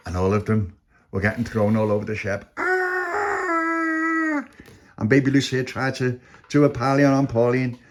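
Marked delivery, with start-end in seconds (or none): 2.6-4.4 long, very high wail; acted scream. A narrator's reading. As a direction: scream as if thrown across deck. Thrown over the ship.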